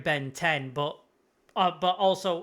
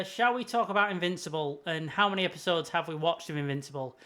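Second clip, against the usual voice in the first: ordinary talk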